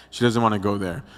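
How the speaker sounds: ordinary talk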